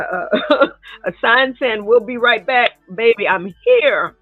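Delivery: ordinary talk, nothing marked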